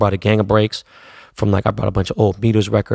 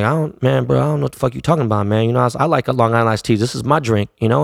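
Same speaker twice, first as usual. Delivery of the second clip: rapid, slightly raised protest; fast defensive reply; 2 semitones higher, faster than usual. Retold or imitated. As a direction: rush the denial. Retelling own defensive reply.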